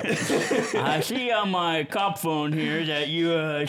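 slow, laughing dim henchman cop; lightly voiced police goon. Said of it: "use your goon voice".

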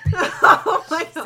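breathless laughter, drawn out; laughing hard. Real, not acted. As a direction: gasp it out through laughter. Laughing until crying.

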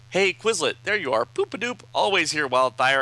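high, loud mock-cheery greeting; perky comic character. Comic character greeting Quizlet, perky and high. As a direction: play it high and bouncy like a cartoon.

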